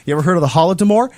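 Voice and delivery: retold Russian man pressing a young college girl, loud and quick; pointed challenge. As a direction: fire the question at her. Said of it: "And he says".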